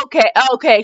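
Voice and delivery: ordinary delivery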